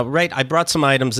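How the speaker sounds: ordinary talk, nothing marked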